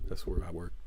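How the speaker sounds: ordinary delivery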